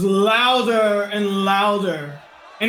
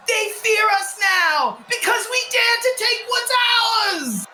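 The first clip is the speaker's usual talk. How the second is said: angry rioter yelling, much higher; crowd shouting back; 9 semitones higher than usual. Acted. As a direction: bellow defiance at the soldiers. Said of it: "Voices from the crowd shout back".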